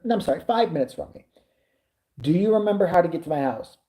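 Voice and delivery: ordinary talk, nothing marked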